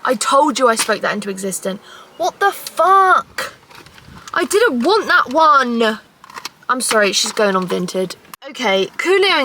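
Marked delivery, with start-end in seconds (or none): none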